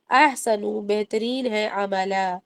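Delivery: ordinary talk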